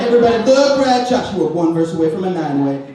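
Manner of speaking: loud, high hyped-up announcer call; rally the crowd like a hype man; mock hype shout. Comedian hyping himself to the girls, loud mock cheer. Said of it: "And I'm like".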